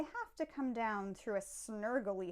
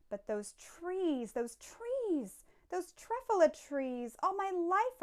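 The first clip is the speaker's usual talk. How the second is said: building wonder; 7 semitones higher than usual. Acted. Rapturous memory of the trees.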